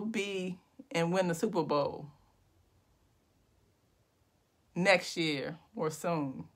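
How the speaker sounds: slow, drawn-out poem recital with long pauses; spoken-word poetry. Pretend. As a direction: make up a poem line by line, savouring each phrase. Improvised poem resumes after laughter.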